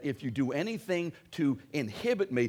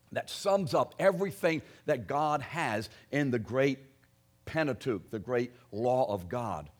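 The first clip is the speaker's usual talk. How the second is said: ordinary talk, nothing marked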